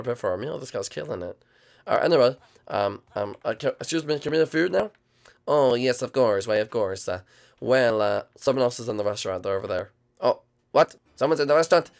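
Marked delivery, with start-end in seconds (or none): none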